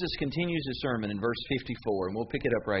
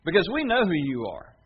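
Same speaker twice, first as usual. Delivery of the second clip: loud, higher mocking retort; sarcastic crowd; 6 semitones higher, 5 dB louder than usual. The crowd taunts Jesus about his parents, loud and sarcastic. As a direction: sneer back at him, sarcastically. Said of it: "kinda sarcastically".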